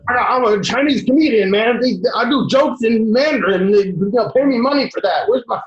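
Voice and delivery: mock coked-up Chinese comedian boasting about getting paid; hyped-up comedian on cocaine. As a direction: brag like a wired comic demanding his due.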